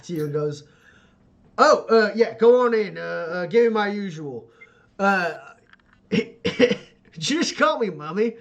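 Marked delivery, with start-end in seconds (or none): 1.6-8.4 louder, higher offhand call; breezy call-out. Acted. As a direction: toss it over your shoulder at the saloon. Tusk calling out at the saloon.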